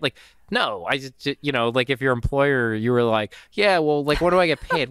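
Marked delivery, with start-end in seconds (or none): 3.5-4.9 higher and louder, asking keenly; a new hire pressing on the pay question; mock eager employee. New hire asks the employer about pay, keen and loud.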